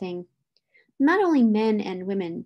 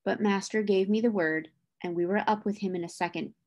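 usual speaking voice

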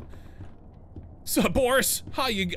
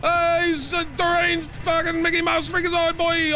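high, loud panicked swearing; shrieking in real fright; jumpscare scream; 11 semitones higher, 6 dB louder than usual. Real, not acted. Streamer jumpscared by the deranged mascot, shrieking high and loud.

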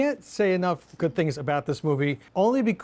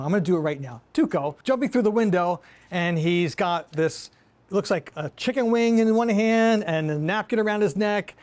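ordinary talk, nothing marked

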